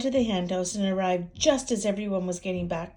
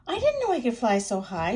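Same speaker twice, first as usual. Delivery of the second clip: proud; 4 semitones higher, 5 dB louder, faster than usual. Acted. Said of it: "she clucked proudly".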